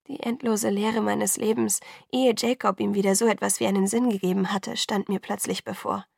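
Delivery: usual speaking voice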